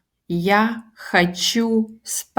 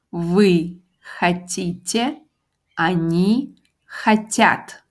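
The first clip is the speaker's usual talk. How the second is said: each form said slowly and clearly; slow conjugation drill; slower than usual. Shown as a demonstration. Teacher reading the verb forms slowly to the class. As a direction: spell out every form for the learner. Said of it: "get acquainted with others".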